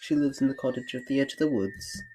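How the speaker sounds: high, put-on English girl; English accent. Red explains her basket to the wolf, high English accent. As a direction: answer primly in an English accent. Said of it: "you're gonna be English".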